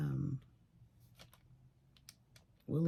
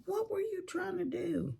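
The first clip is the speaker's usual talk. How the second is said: much higher, incredulous; mocking Cameron. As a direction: imitate him scoffing at her cooking. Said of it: "Cameron like".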